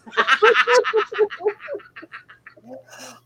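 loud, high burst of laughter. Real, not acted. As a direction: crack up loudly. Cracks up at own joke.

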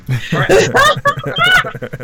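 a bright high burst of laughter. Real, not acted. Laughing at her own joke about her accent. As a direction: laugh it off at herself.

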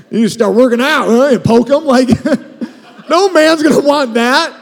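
loud and high, hammed-up scolding; bully them about their shape, jokingly; mock harsh nagging. Spouse mock-scolding partner to work out, hammed-up loud. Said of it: "You gotta be like".

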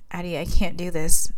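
usual speaking voice

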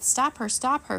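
high, loud squeak; squeaky voice. A narrator's reading. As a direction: squeak out the alarm. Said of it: "Flit squeaked".

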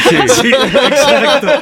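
talking through real laughter; giggling while agreeing about the joke; laughing. Real, not acted.

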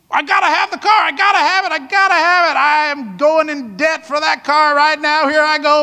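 high, loud, insistent craving; impulsive car buyer. Retold or imitated. Impatient buyer demands the car, going into debt loudly. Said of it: "I mean".

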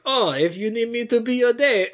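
high, badly faked Italian accent; Philippe. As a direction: attempt a dubious European accent. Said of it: "it sounds so wrong coming out of my mouth".

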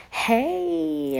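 high, bright mock greeting from the nurse; imitating excited nurse. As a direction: pop it up like a thrilled nurse calling. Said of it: "she was so excited".

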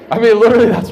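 loud, high, laughing outburst; laughing loudly at his own joke; laughing burst. Real, not acted. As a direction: break into real laughter.

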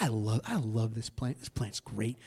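low, hushed fondness for the plant; happy, contented Jonah. Acted. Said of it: "he's very happy about that plant".

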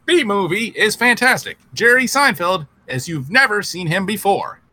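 high robotic movie review; GPS robot voice. Acted. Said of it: "like if a G P S reviewed movies".

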